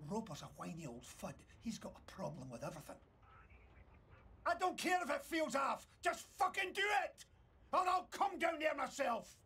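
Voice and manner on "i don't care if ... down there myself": furious shout down the phone; yelling. Acted. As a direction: bellow the order. Boss yells down phone.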